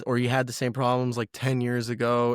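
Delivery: usual speaking voice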